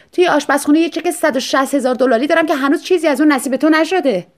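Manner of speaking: high, loud, excited; eager buyer. Acted. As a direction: pounce on the salesman. Wife suddenly eager to buy.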